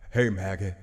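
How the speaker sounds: low, put-on villain greeting; smug bad guy saying hello; Negan. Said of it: "that's my Negan voice".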